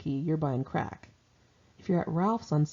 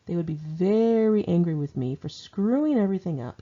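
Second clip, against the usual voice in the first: plain reading voice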